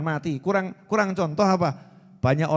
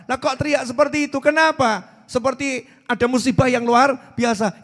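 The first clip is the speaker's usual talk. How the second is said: puzzled villagers; 5 semitones higher, 5 dB louder than usual. Acted. Villagers question the shouting.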